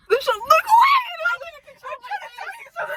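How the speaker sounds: high, loud exasperated calling; miming frantic charades in math class. Retold or imitated. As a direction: push it out, exasperated.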